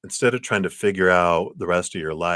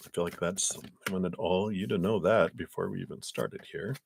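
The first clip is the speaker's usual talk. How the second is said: ordinary delivery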